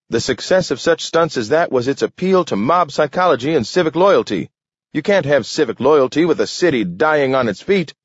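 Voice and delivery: high, fast, impassioned oratory; ranting alderman. A narrator's reading. Alderman lecturing the council on a dying city, impassioned. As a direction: harangue the council without letting up.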